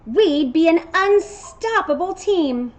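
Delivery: loud and high, bursting with an idea; storybook goat full of enthusiasm; excited goat. Acted. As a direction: pitch the big team-up.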